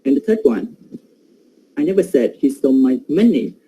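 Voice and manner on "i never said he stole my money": "money" stressed at the end with a falling tune. Shown as a demonstration. Teacher showing the class prominence, stress on "money". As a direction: land on "money" so it sounds like something else was stolen. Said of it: "the syllable men in the money is giving prominence".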